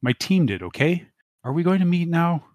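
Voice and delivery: high, pleading whine; plaintive. Acted. Dante begging Kimmy to meet, plaintively. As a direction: plead to see her again. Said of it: "he asks plaintively".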